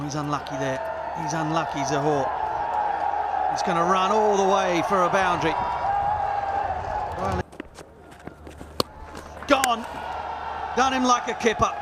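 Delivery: normal talking voice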